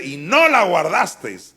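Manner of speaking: a hint of Stephen's loud charge; accusing rebuke. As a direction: drive the charge home. Stephen's rebuke quoted again.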